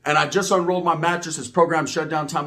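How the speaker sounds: ordinary talk, nothing marked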